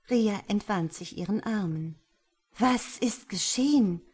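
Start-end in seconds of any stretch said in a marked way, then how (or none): 2.6-4.0 bewildered, raised voice; startled, confused question. A narrator's reading. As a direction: ask it louder, at a loss. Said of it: "fragte sie verwirrt".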